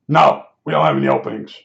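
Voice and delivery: low, quick brush-off imitating the receptionist; mock a curt refusal, pitched well down; dismissive receptionist. Receptionist's refusal acted out in retelling.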